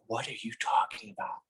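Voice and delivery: a quoted, puzzled whisper; baffled whisper. Retold or imitated. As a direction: hiss it in disbelief. Family baffled at his spoiler.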